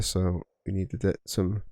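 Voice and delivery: usual speaking voice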